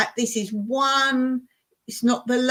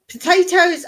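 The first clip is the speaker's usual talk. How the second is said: excited; 9 semitones higher, 8 dB louder than usual. Real, not acted. Gushing about favourite food.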